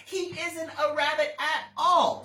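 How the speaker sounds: high, loud jeering with a laugh; laughing mocking rabbit. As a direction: laugh him off like a bully.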